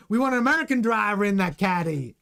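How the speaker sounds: exaggerated fake Texan American accent. Shown as a demonstration. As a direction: put on a bad American accent for laughs. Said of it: "That's my American accent".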